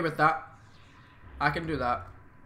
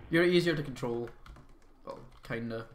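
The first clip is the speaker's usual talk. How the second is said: ordinary talk, nothing marked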